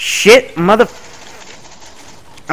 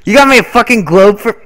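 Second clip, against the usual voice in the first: mock outrage; 6 semitones higher, 6 dB louder than usual.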